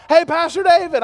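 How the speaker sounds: put-on high, bright call of a young girl; a young lady calling out hello to her pastor; girl's greeting. Girl greeting him, retold.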